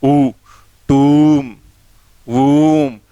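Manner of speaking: ऊ, टूम, वूम each with a loud, long oo; long oo sound. Shown as a demonstration. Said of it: "इसमें long ऊ का है".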